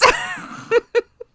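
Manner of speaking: high-pitched burst of laughter. Real, not acted. Laughs at own joke.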